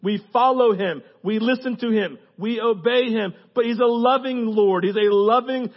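loud, high, punched phrases; full-force preaching. Real, not acted. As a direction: drive each short line home to the crowd.

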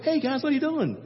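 high, light and breezy; casual. Retold or imitated. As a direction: toss it off like nothing's wrong. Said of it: "It wasn't like".

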